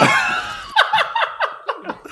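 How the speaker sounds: shrieking laugh. Real, not acted. Reaction to clip.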